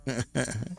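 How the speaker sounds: soft little laugh; brief, quiet chuckle to oneself; short chuckle. Real, not acted. Host still waiting for guests to join, short chuckle.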